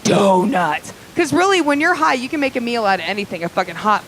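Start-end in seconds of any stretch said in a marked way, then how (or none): 0.0-0.9 hammed-up stoner rejoicing; stoned delight. Stoned clubber spotting a donut cart, hammed-up joyful yell. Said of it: "and be all".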